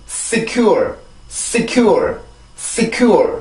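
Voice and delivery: secure with a thin hissed S, then stressed falling CURE; English pronunciation. Shown as a demonstration. Teacher drilling learners on the word, careful English pronunciation. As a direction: model secure as s-KYOOR, final r kept. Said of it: "이 단어는 그렇게 발음이 돼요".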